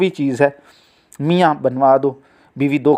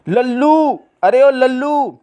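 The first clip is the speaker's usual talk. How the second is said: high, drawn-out call to a servant; 8 semitones higher, slower than usual. Acted. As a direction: summon someone from another room. Wife calls the servant.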